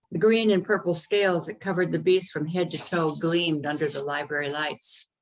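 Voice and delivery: plain reading voice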